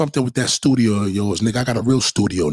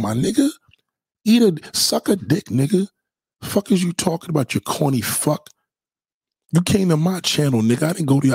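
ordinary delivery

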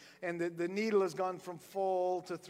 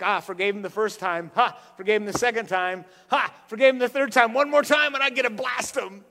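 louder, gloating tally of each pardon; mock grudge counting; 9 dB louder than usual. Preacher playing a man keeping score, louder and gloating. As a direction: count off the forgivings smugly.

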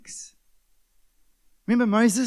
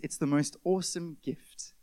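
ordinary delivery

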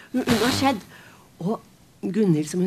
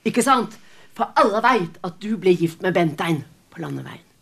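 scornful insult; 4 semitones higher, 6 dB louder than usual. Acted. Grieving mother flings the cloth at Kristin, loud higher scorn. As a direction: spit the insult at her.

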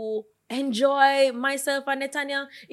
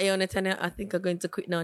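soft quoted text; 6 semitones lower, 8 dB quieter than usual. Retold or imitated. Quoting own text to her.

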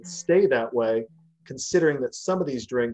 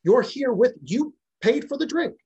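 much higher, defensive and indignant; flustered protest; 7 semitones higher than usual. Retold or imitated. Bartender protesting to the angry husband, high and flustered. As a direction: pitch jumps up as he pleads his innocence.

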